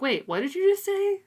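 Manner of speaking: high, surprised squeak of a question; startled disbelief. Retold or imitated. Sarah startled by the sudden proposal, high and surprised. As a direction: act caught off guard, asking him to repeat.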